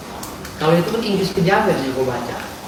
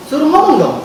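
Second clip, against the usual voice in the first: much higher and louder dare; loud challenge; 10 semitones higher, 5 dB louder than usual. Real, not acted. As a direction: throw down the dare loudly. Challenging Hotman to speak. (tantangan keras ke Hotman)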